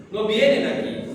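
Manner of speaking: ordinary talk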